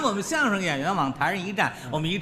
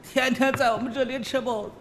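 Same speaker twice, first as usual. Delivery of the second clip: mock tearful; 4 semitones higher than usual. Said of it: "拉着我的手直掉眼泪".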